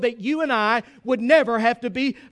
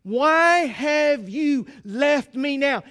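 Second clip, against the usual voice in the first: acted anguished cry to the Father; much slower than usual. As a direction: draw it out slowly, pleading from the cross. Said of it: "He is crying out to his Father".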